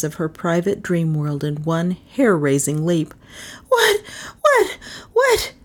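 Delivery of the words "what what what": very high, panicky yelps; startled gabbling. A narrator's reading. Melissa jolted out of sleep, gabbling in alarm. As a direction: yelp each word as if jolted awake. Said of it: "she gabbled".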